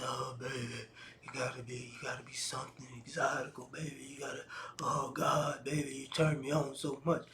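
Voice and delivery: low, soft, sleazy come-on with whispered words; mock seductive guy. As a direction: breathe it like a cheesy pickup line. Guys hitting on women, mocked.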